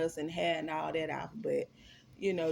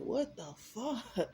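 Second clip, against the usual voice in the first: retold crying; 5 semitones higher, much slower than usual. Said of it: "So I'm crying".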